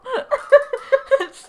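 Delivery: loud high-pitched burst of laughter. Real, not acted. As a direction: crack up laughing hard. Laughing at shared tears.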